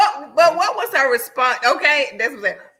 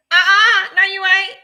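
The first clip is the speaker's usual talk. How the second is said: raised, high-pitched refusal; loud objection; 7 semitones higher, 7 dB louder than usual. Retold or imitated. Friend replaying how she stopped him leaving without paying, loud objection. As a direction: shut it down loudly.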